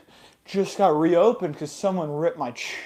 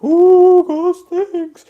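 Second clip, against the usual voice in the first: high, hooting mock ghost; spooky ghost; 12 semitones higher, 8 dB louder, much slower than usual. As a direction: wail it high like a cartoon ghost. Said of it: "so now I'm a ghost".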